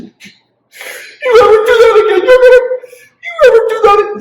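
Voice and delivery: hammed-up furious parent shouting a threat; mock angry yell. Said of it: "We didn't say".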